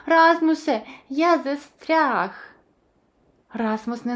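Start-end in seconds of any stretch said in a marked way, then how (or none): 0.1-2.3 high loud cry for help, slowing; calling out. A narrator's reading. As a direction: shout for a friend while stuck. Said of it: "А тоді закричав".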